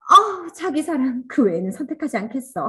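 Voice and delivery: mock infatuated vow. Teacher mimicking an over-zealous reader: high loud gushing vow. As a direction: gush it like a smitten convert. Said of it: "하면서".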